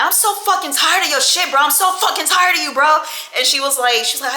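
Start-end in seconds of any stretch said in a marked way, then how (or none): 0.0-3.0 higher, louder furious rant; retold angry rant. Fed up with girlfriend, higher angry rant. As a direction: spit it out at her, high. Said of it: "And I'm like".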